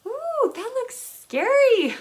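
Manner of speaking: high, slow mock fright; mock scared. Reacting to creepy illustrations, mock scared and high. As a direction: gasp it out like a spooked kid. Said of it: "I was like".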